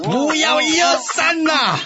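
very high, sustained shriek; exasperated yell. Acted. Sun Quan scolding generals' counting, exasperated high yell. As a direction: lose patience and cry out high.

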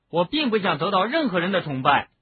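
acted boy's angry denial; indignant. A narrator's reading. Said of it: "哈利气愤地说".

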